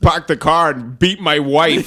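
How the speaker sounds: loud, slow mock Boston accent. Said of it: "looking me in the eye going".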